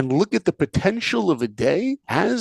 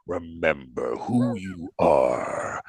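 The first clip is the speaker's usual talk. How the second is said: much lower, slow solemn voice; ghostly father; 10 semitones lower, 3 dB quieter, much slower than usual. Retold or imitated. Simba's father from the sky, slow deep solemn command. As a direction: intone it slow and deep from above.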